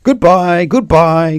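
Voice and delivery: high, loud, cheery hello; Mister Wrong greeting. A narrator's reading. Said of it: "greeted Mister Wrong".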